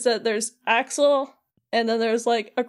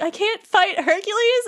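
put-on high, upset outburst of a kid denied his fight; dismayed Sora; 9 semitones higher than usual. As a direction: cry it out, much higher, like a disappointed boy. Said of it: "he's just like".

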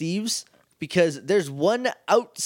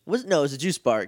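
usual speaking voice

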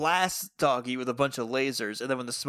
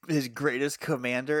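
ordinary talk

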